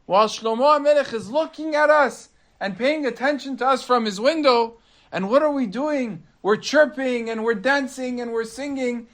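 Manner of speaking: high, urgent little bird voice, rising as the fear builds; a frightened bird warning the others to flee; alarmed bird. Acted. First bird warning the flock about the new king, high and alarmed.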